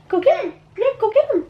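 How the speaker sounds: high-pitched urging. Real, not acted. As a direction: send a little one off in play. Urging toddler to go get daddy.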